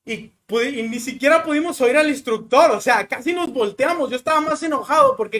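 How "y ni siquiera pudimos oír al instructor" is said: ordinary talk, nothing marked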